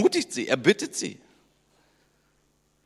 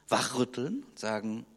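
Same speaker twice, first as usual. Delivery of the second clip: ordinary talk, nothing marked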